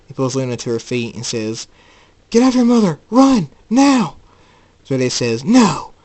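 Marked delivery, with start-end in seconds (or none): 2.3-4.2 loud, high, separate bursts; urgent shouted order. Acted. Jacob pulling mother to her feet, urgent shout. 5.4-5.9 loud, high-pitched refusal; angry shout. Acted. Zerde stopping the escape, angry shout.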